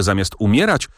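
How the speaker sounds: ordinary delivery, nothing marked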